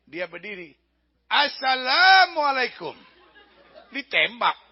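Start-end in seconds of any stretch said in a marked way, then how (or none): 1.3-2.9 loud, high, drawn-out greeting; slow, booming acted reply; hearty called greeting. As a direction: sing out the greeting proudly across the distance.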